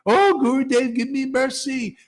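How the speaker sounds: high, mock-pleading plea; pleading disciple. Disciple mocked begging the guru for mercy, high pleading voice. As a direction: beg like a hammed-up disciple. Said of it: "And by".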